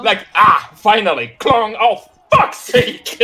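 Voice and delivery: relief tipping into exasperation; laughing mock outburst, rising at the end; exasperated bandit. Bandit whose crossbow fails, exasperated and hammed-up.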